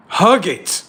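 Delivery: sharp, forceful command; stern command. Acted. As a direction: order a stubborn kid to obey. Strict self giving orders.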